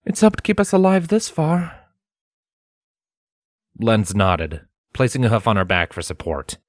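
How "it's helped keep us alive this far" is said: high voice, matter-of-fact; Scarlet, plainly. A narrator's reading. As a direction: state it flatly to Lens. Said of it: "she pointed out plainly".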